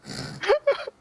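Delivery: very high short laugh; quick high burst of laughter. Real, not acted. Player laughing at the blank stare, high laugh.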